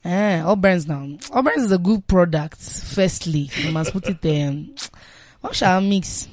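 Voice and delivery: slow, emphatic Nigerian Pidgin answer. Pretend. Asked about breakfast, answering in Nigerian Pidgin. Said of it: "can you answer in, like, Pidgin".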